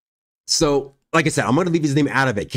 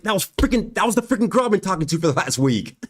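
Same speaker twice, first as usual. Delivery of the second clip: shocked realization; 6 semitones higher than usual. Retold or imitated. Realizes it was his girlfriend.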